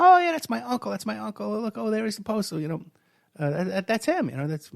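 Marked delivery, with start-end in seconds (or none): 0.0-2.8 excited date. Retold or imitated. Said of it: "She goes".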